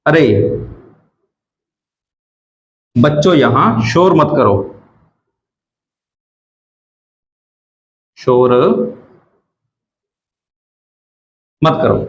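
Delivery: a hint of a teacher hushing the class; mild scolding. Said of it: "उदाहरण के लिए". (बच्चों को हल्के से डांटती टीचर)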